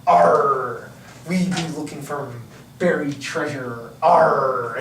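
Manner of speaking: gruff "arr" pirate talk. Acted. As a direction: talk like an old sea pirate.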